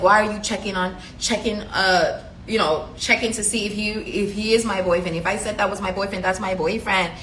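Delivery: normal talking voice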